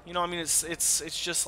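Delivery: ordinary delivery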